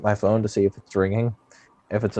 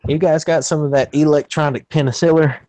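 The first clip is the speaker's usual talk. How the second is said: higher, louder bumpkin question; 6 semitones higher, 5 dB louder than usual. Retold or imitated. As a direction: ask it as a clueless country customer. Acts clueless hick to scammer.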